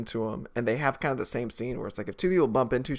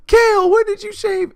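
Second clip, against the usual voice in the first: mock screaming; 11 dB louder than usual. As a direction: shriek it in overblown panic. Screaming at each other.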